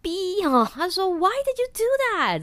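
high, loud startled outburst; shocked swearing. Retold or imitated. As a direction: blurt it like you just got pranked. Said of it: "他就骂脏话".

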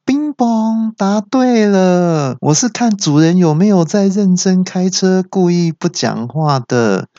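高亢拉长的主持人腔; 像公布答案的综艺主持人; 益智节目主持人. Acted. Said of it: "又变成益智节目主持人了". (导航机装成益智节目主持人)

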